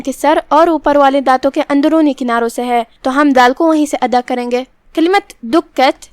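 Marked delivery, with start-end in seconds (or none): 4.9-6.1 slow careful reading of دکت with a doubled کاف; teacher sounds out دکت slowly, holding the کاف; slow demo recitation. Shown as a demonstration. Teacher showing students the word, slow and careful. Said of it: "یہاں کاف پہ تشدید ہے".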